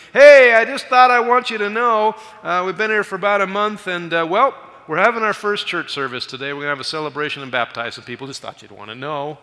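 high, loud shout easing down to casual; acted phone shout, settling as it goes; calling over noise. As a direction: yell the news down a noisy line. Acts friend calling from police station.

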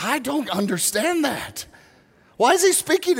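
a mock bewildered question; baffled. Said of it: "And I'm like".

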